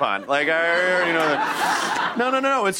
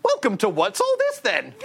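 mock show host; 12 semitones higher than usual. Host joking mock show intro.

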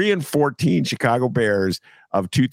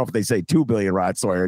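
usual speaking voice

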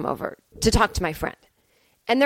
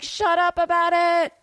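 hammed-up annoyed whine; mock exasperation; 8 semitones higher than usual. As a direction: imitate fed-up listeners, pitched up. Acts out fed-up listeners' reply.

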